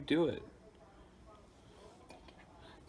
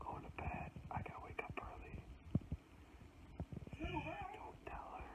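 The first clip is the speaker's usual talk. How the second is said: hushed whisper; 21 dB quieter than usual. Real, not acted. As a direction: whisper so no one hears. Sneaking off, camera still on.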